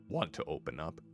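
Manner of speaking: ordinary delivery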